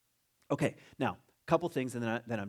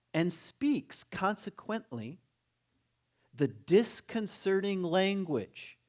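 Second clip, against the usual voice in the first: very slow, higher, deliberate reading; drawn-out, emphatic recitation; reading aloud; 5 semitones higher, 3 dB louder, much slower than usual. Acted. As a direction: read it out with weight on each phrase. Reads a quoted passage aloud.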